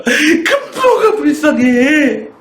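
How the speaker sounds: very high, slow, laughing wail of a sobbing frog; hammed-up sobbing frog, high and drawn out; crying frog voice. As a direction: whimper it like a weepy little frog through laughter. Crying frog answers again.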